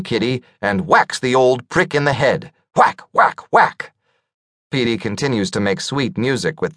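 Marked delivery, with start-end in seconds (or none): 2.7-3.9 voiced whack whack whack hits; shovel whack sounds. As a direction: stamp out each blow.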